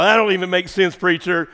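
usual speaking voice

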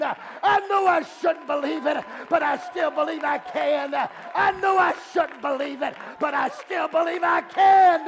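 loud, high-pitched preacher's cry; impassioned pulpit shout, pitched high; full-force preaching. Acted. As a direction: preach it in driving, repeated refrains.

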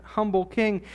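ordinary talk

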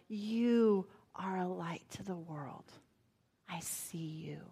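tender whisper. Retold or imitated. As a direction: breathe it over a baby in your arms. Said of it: "I can see him cradling his head and saying".

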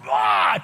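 loud mock outburst; a sudden loud angry "ah"; the angry driver's loud wordless yell. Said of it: "this guy went from".

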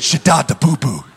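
low, laughing babble of fake tongues; mock tongues. Critic ridiculing a prayer language, laughing babble. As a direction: babble it like a joke. Mocking tongue-speech again.

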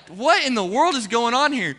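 loud, high-pitched disbelief; retold indignant outcry; bewildered protest. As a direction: protest as if suddenly manhandled. Retold bewildered protest against the wall.

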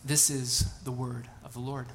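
ordinary delivery

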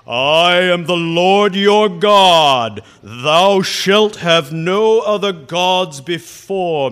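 huge, slow, thundering voice of God; grumpy basso profundo God, very loud and slow; booming God voice. Retold or imitated. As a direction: boom it out like a movie God on the mountain. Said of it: "I'm gonna give you my best impersonation".

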